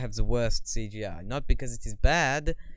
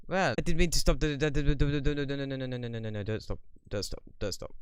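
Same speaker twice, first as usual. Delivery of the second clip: rapid stutter sinking quieter; flustered stammering; 5 dB quieter, much faster than usual. Real, not acted. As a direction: trail down through frantic repeated nos. Nearly stopped the livestream by accident.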